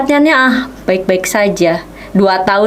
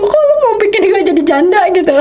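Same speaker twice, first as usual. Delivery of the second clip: high-pitched indignant mock scolding; scolding God; 9 semitones higher than usual. Wife scolding God like a friend, high and indignant. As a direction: confront him like an exasperated best friend. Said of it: "aku bilang gitu".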